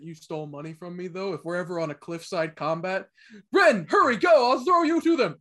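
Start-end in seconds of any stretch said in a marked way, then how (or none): 3.5-5.3 hurried, loud and high urging; urgent shout. Pretend. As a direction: yell at a friend to hurry. Urgent call in cliffside fight.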